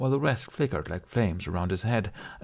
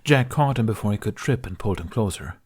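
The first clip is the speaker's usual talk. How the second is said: usual speaking voice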